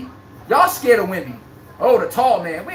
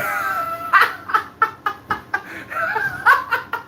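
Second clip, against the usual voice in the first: long high cackling laugh; real laugh at a chat joke; high burst of laughter. Real, not acted.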